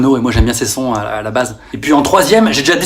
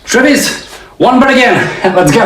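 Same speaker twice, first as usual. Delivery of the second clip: calling out; 8 semitones higher than usual. Acted. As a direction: shout to a friend by pool. Star calling at pool. (appel fort et aigu)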